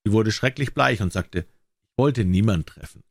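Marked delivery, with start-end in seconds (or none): none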